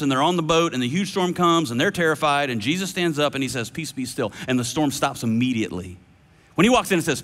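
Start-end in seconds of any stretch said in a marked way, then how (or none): 3.7-4.3 calm hushed command. Acted. Jesus calming the storm, quoted.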